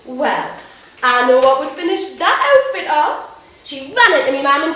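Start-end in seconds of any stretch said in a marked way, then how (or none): 0.1-3.3 loud, excited grandmother voice; delighted Nana. Acted. Nana hears of her granddaughter's new friend, loudly delighted. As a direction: gush with swooping, raised pitch.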